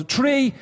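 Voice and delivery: Three punched high and long; stress on three. Shown as a demonstration. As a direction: hit the word Three hard. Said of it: "three, not one".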